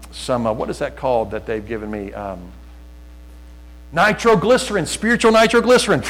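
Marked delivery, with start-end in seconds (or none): 3.9-6.0 high, excited burst of recall; sudden exclamation. Real, not acted. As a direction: blurt the word out, pitched high. Finally recalls the word, exclaims it.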